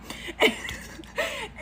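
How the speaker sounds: high, breathy giggle at herself; embarrassed laugh. Real, not acted. Storyteller laughing at her own desperate voodoo story. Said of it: "I can't even say this seriously".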